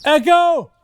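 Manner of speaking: loud high call into the distance; calling out for echo. Shown as a demonstration. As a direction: shout it out to hear the echo. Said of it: "I'll do it again".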